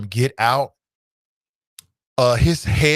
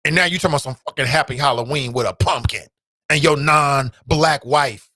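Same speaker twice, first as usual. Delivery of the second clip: ordinary talk, nothing marked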